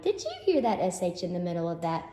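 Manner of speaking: ordinary talk, nothing marked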